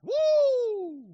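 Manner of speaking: high, loud joyful woo; ecstatic whoop. Real, not acted. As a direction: shout for joy. Preacher's ecstatic cry.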